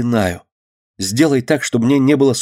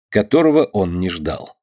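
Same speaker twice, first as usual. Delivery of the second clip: plain reading voice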